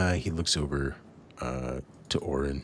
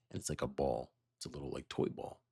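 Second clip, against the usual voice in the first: normal talking voice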